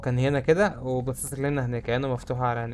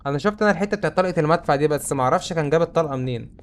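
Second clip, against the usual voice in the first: ordinary talk, nothing marked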